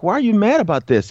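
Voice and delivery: retold puzzlement. His own retold puzzled reply.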